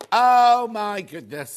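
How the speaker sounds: a sudden loud oh of real surprise; surprised exclamation. Real, not acted. Presenter notices the missing popper: loud surprised oh. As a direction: blurt it out, caught off guard.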